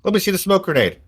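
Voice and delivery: put-on high-pitched kid's demand; street kid. Kid asking to inspect the grenade, high-pitched. Game master voices the kid.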